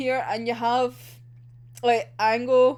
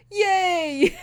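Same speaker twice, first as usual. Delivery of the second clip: mock cheer; 8 semitones higher, 3 dB louder than usual. Mocking the cheerful cut.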